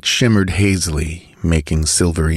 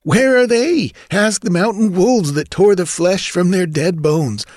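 high-pitched, agitated bandit argument; sullen henchman; 12 semitones higher than usual. A narrator's reading. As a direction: insist fearfully to your chief. Henchman argues with bandit chief.

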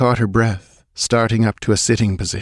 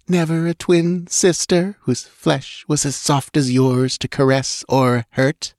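slow, high, sweetly venomous taunt; poisonously mocking; 6 semitones higher than usual. A narrator's reading. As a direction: draw it out sweetly to mock her. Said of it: "poisonously mocking voice".

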